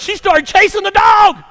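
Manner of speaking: very high, full-volume story climax; excited shout. Real, not acted. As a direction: holler the triumphant punchline.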